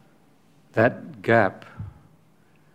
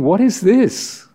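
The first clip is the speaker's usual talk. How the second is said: much higher, slow baffled question; puzzled crowd; 11 semitones higher, 5 dB louder than usual. Retold or imitated. Crowd thinking over Jesus's words, baffled and high. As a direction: voice the crowd's bewilderment. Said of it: "they're listening and thinking".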